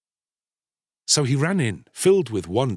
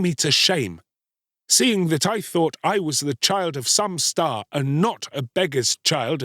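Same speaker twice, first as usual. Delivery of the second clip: bitter, higher-pitched reproach; hard and bitter; 7 semitones higher than usual. A narrator's reading. Ashamed boy rejecting his mother, hard and bitter. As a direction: shame her and send her off. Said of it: "his voice was hard and bitter".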